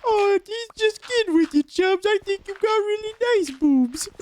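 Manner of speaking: put-on very high, strained old man voice; Whitey's voice. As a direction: squeak it like a frail old coach. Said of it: "Whitey goes up to him. He's like".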